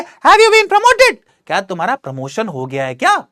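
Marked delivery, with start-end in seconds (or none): 0.0-1.2 much higher, surprised female voice; Samantha's voice. Acted. Teacher voicing Samantha, high and surprised. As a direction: ask it surprised, pitched up high. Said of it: "Samantha पूछती है".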